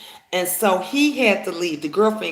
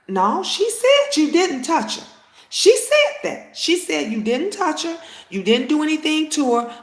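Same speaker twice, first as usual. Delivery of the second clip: higher-pitched, emphatic retold rebuttal; insistent correction; 7 semitones higher than usual. Mother to accused man, retold insistent correction. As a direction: hammer home each point, voice raised in pitch.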